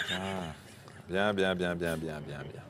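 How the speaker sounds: trailing off to near silence; fading mutter. Real, not acted. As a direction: murmur it away to nothing. Trailing, composing himself.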